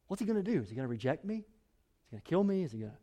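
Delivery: ordinary talk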